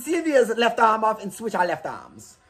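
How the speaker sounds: put-on German accent. Sisters ask the surgeon in a cartoon German accent. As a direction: ham up a Bugs Bunny style German accent. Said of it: "stereotypical Bugs Bunny German accent".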